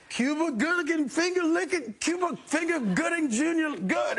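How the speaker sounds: high mock announcer voice. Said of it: "It was like".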